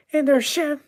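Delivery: very high falsetto voice; high-pitched, frightened squeal; panicked report. Acted. Kitchen staff's panicked report acted out.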